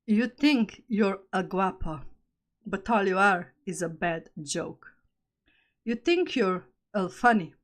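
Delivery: loud, measured verse reading with punched openings; poem recital. Acted. Poet calls out a boastful guy, loud deliberate recital. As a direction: recite it firmly, pausing after each line. Reads own poem aloud.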